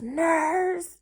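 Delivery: high, loud call for the nurse; retold panicked yell for help. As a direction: shout across the room in alarm. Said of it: "And he yells".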